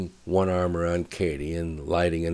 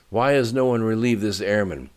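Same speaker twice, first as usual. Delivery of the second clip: colonel's raised voice, higher and louder; shouted demand; 6 semitones higher, 7 dB louder than usual. A narrator's reading. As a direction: demand answers loudly from the crew. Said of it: "shouted the colonel".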